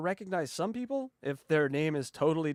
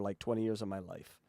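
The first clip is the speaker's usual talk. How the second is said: normal talking voice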